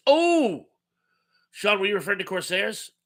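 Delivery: sudden high Oh of realisation; light up at the news; surprised. Real, not acted.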